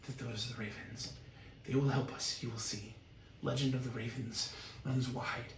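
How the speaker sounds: very soft, under the breath; hushed insistence. Acted. Villager assuring the woman the ravens will help, hushed. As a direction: insist quietly, with halting pauses.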